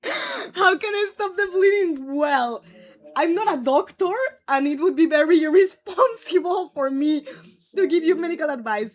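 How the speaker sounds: normal talking voice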